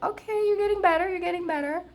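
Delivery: high cooing pet voice, quick; high sing-song baby talk for a pet; baby talk to dog. Retold or imitated.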